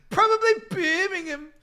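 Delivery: high, loud mock British accent; imitated British accent. Riffing on a hilarious video, imitated British accent. Repeating the video catchphrase, laughing.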